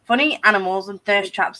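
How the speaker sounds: ordinary talk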